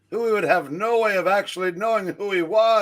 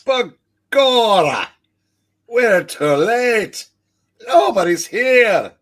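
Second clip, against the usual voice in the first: put-on Irish accent; 6 dB louder than usual. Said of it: "who's already for an Irish cop".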